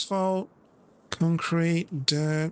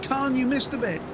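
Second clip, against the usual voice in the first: higher-pitched retold outcry; mock cry out; 7 semitones higher than usual. Viewer calling out a missed bit, mock outcry. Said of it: "one viewer cry out".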